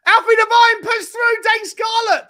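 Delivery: high, loud play-by-play shout; excited commentary. Real, not acted. Fan calling Tottenham's attack, excited shout. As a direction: call the through ball at full excitement.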